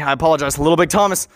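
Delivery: ordinary delivery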